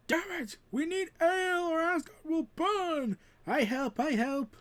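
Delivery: high, harried voice; a stressed Asgardian steward shouting at the crowd; frantic official. Acted. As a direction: bark orders over a rioting crowd.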